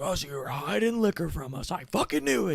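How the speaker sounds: high-pitched imitation of an outraged cop; mock screaming. Mean cop finds hidden liquor, mock screaming at the teenagers. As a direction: mimic a scream without much volume. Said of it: "he's, like, screaming at us".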